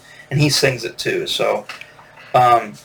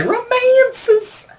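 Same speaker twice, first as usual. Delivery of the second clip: very high, stretched-out mock rock vocal. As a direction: squeal it high like a flamboyant singer. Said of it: "It's the way he'd say that".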